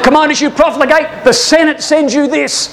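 high, declaiming assassin; acted-out denunciation, pitched well up; attacker's challenge. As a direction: announce the deadly blow to the emperor. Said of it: "He said".

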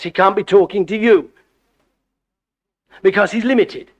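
ordinary talk, nothing marked

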